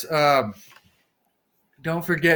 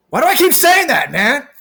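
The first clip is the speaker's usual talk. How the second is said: much higher, louder, exasperated at himself; frustrated outburst; 10 semitones higher, 7 dB louder than usual. Real, not acted. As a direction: blurt it out, fed up with yourself. Scolds himself for repeating a phrase.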